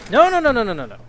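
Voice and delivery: sudden high, loud burst of no's; urgent protest. Real, not acted. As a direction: protest loudly in a rapid high string. Protests being locked in by game.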